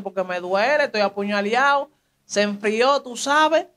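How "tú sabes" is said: ordinary delivery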